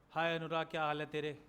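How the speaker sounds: low monotone greeting; expressionless. Shown as a demonstration. Teacher mimicking a friend's lifeless greeting, expressionless. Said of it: "ये expressionless conversation".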